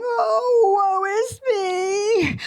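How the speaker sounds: put-on high, drawn-out crying wail of a self-pitying old woman; wailing old lady. A narrator's reading. Old lady wails her misery to the fairy, high sobbing. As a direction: sob it out slowly like a whiny old lady. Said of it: "the little old lady was again crying".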